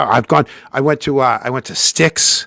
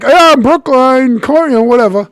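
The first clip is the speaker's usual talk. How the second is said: mock bluffing; 12 semitones higher, 8 dB louder than usual. Fake fireman answers a stranger in the beer line, hammed-up bluffing. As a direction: bluff it loudly, pitched way up.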